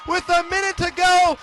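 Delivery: thrilled winning call. Real, not acted. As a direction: roar it like the game is over. Overtime winner sends team to state.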